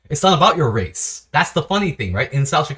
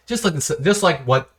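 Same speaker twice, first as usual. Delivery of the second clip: normal talking voice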